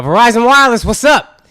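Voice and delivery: high, loud, breezy phone pickup; cocky casual greeting. Retold or imitated. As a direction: answer the phone like you don't care.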